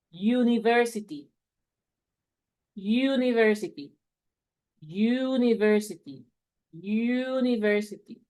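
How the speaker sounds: "University" slow, starting with a clear "you" sound; model pronunciation. Shown as a demonstration. Teacher repeats the word slowly for learners, "University" with a yu start. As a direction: draw out "University" from a yu onset, syllable by syllable. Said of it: "Lo repito porque es interesante".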